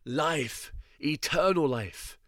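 lightly voiced high cry of life, life. A narrator's reading. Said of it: "ran on crying".

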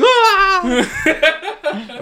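loud high mock scream; imitated scream. Kids screaming as the power goes out, mock scream. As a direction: scream like startled kids, played for laughs. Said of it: "screamed and kinda like".

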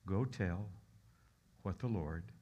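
ordinary talk

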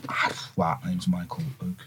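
soft, low, stunned reply; starstruck hush. Retold or imitated. Meeting William Regal, answering in a low awed murmur. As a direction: mumble it, starstruck. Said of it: "I was like".

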